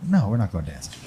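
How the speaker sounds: deadpan low impression of the older woman; deep mock woman voice. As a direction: drop way down for her flat no. Woman's flat refusal, acted quote.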